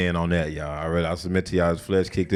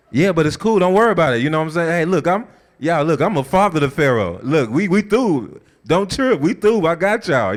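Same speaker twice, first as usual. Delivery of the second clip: eager reassurance; 8 semitones higher, 4 dB louder than usual. Acted. Joseph reassures stunned brothers.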